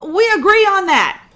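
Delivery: high, loud sarcastic shout; shouted put-down; loud retort. Acted. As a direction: Momi yells her agreement. Momi snapping back.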